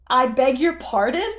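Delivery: high, loud startled cry; stunned, shouting down; shocked shout. Acted. Groove on the tower, shocked, shouts down.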